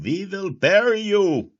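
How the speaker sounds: hammed-up foreign-dictator bluster, dragged out slowly; loud, slow, mock German accent; Khrushchev with a German accent. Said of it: "That was more of a German accent, I'm sorry".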